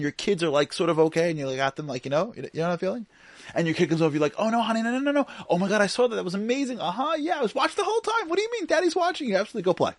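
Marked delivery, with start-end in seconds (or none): 4.4-9.9 loud, high-pitched distracted dad fobbing off his kid; hammed-up busy dad, pitched way up; multitasking dad. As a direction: rattle off over-keen reassurance while busy on a call. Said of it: "typical, like, multitask dad mode".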